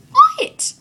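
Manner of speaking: very high, loud outburst; exasperated yell. Retold or imitated. Girl to the boy hitting on her, exasperated yell. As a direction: yell it in shrieking disbelief. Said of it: "I wanna yell at him".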